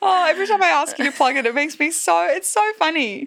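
high, quick, tickled delivery; amused, laughing. Real, not acted. Teasing co-host after asking for the plug, tickled. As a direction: talk while recovering from laughter.

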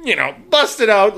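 usual speaking voice

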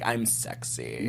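low, slow, breathy come-on; sultry voice. Retold or imitated. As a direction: purr it out like a seductress. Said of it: "She's like".

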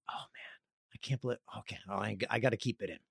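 breath catches as he fights not to cry; holding back tears. Real, not acted. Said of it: "I'm gonna cry talking about it".

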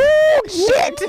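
high, loud shocked yelp; mock alarm. To friends, shocked at the late hour, mock alarm. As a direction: act like you just saw the clock. Realizing it's already late.

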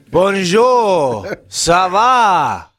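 hammed-up French chef hello; French greeting. Host greeting listeners in a hammed-up French accent. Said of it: "I thought it was more like, more like Mister Cleese".

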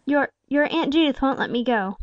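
falter, perplexed and cornered. A narrator's reading. Aunt excuses herself to the boy, faltering. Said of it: "faltered Salome".

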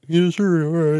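lightly put-on dopey echo; dumb voice. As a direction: echo the mocking impersonation of yourself. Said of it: "Is that what I sound like?".

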